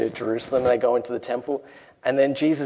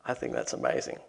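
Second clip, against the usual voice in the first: awed whisper; 7 semitones lower, 9 dB quieter than usual. Real, not acted. Awed aside after the story.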